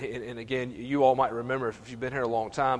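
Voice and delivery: ordinary talk, nothing marked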